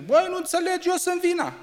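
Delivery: very high, loud mock yell at wife; husband yelling at his wife, mock-high. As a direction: squeal it like a husband losing the argument. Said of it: "când țipați la neveste".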